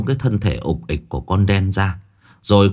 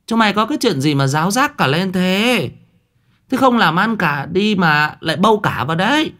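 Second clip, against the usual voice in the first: high, scolding wife's voice; shrill scolding of the servants; shrill old wife; 10 semitones higher than usual. A narrator's reading. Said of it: "tiếng nói the thé của bà cả". (giọng the thé của bà cả mắng người ở)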